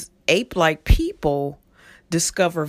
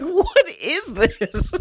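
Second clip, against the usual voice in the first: high, slow, laughing question; laughing disbelief; 12 semitones higher, much slower than usual. Retold or imitated. Remembering the silly film sound, laughing disbelief. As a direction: ask it while cracking up. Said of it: "I was like".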